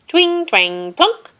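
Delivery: twanging plucks that jump high; banjo imitation. A narrator's reading. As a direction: imitate a tiny banjo with bouncy words. Imitating a banjo being strummed.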